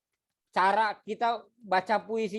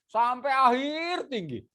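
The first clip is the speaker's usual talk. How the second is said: raised, stretched "akhir"; high-pitched; 6 semitones higher than usual. Shown as a demonstration. Teacher mimicking readers who stay high till the end, high-pitched. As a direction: push the voice up high and hold it. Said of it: "udah suaranya tinggi".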